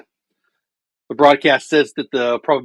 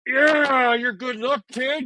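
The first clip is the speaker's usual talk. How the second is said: excited; 10 semitones higher, slower than usual. Real, not acted. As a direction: still thrilled, pitched high. Celebrating the home run.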